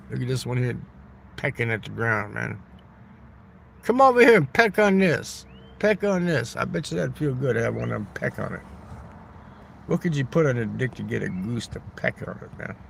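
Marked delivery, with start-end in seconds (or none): 3.8-6.6 calling geese. Real, not acted.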